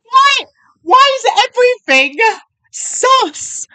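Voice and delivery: high, loud shocked shout; shocked outburst. Real, not acted. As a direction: yell it in disbelief. Shocked by the video.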